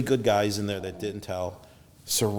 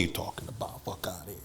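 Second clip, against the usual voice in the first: hushed aside; 3 semitones lower, 8 dB quieter than usual. Retold or imitated. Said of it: "pulling them aside".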